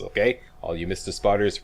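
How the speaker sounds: ordinary talk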